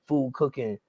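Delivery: ordinary talk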